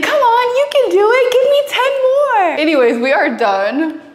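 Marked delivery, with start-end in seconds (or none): 0.0-2.6 high, peppy fitness instructor voice; workout video instructor. Retold or imitated. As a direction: cheer like an aerobics video coach. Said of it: "They'd be like".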